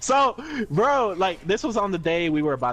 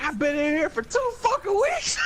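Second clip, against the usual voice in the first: whiny complaint. Retold or imitated. Whiny inmate voiced.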